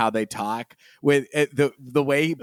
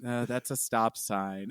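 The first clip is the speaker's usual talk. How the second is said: soft, slow nervous aside; a quiet, drawn-out mock warning; muttered aside; 4 semitones lower, 9 dB quieter than usual. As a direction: murmur it from the back seat, ignored. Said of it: "Anthony's going".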